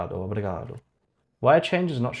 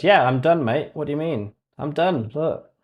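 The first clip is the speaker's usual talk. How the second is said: mock-cheery AI, higher and louder; smug AI assistant; 4 semitones higher, 6 dB louder than usual. Streamer mocks the AI coding tool claiming it's finished, in a smug cheery voice. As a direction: imitate the AI proudly claiming it's done. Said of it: "I love how it just says".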